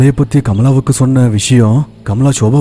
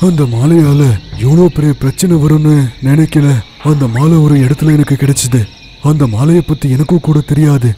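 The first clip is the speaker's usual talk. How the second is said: put-on an old sage admitting ignorance; sage's voice; 3 semitones higher than usual. Siddha guru answers. (சித்தரின் மாறுபட்ட குரல்)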